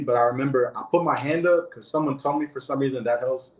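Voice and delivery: ordinary talk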